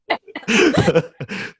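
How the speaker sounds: real laugh trailing off. Real, not acted. Still laughing before telling the story. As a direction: let the laugh fade down. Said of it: "you're laughing".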